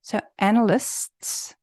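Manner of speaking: "analysts" said slowly with the final S sounded clearly. Shown as a demonstration. Teacher showing students the S ending clearly. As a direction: land the S at the end of "analysts". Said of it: "am I hearing the S".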